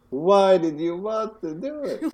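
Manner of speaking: high, slow, whiny disbelief; incredulous. Retold or imitated. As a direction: wail it in mock disbelief. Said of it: "And also".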